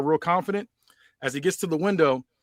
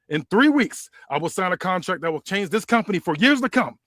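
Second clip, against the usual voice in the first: Rock promo; 3 semitones higher, 4 dB louder than usual. Acted. The Rock addressing the crowd in his return promo, loud and boastful. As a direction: cut a promo as The Rock, hamming it up.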